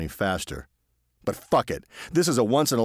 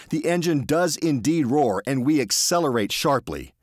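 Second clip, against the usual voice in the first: ordinary delivery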